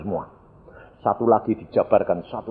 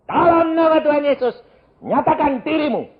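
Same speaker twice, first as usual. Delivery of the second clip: high, loud command to a demon; exorcism command; 8 dB louder than usual. Retold or imitated. Preacher reenacts ordering the demon to reveal itself, loud command.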